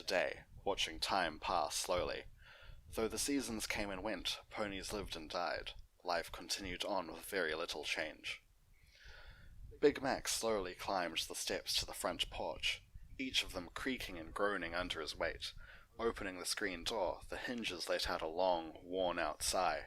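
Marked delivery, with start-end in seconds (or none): none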